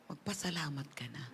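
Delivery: hushed, breathy whisper. Acted. Preacher urging the congregation to give thanks, whispered. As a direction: drop to a whisper to draw them in.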